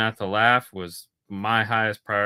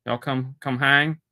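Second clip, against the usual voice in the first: mock Southern invite; 3 semitones higher, 5 dB louder than usual. Imitating pushy Southerner.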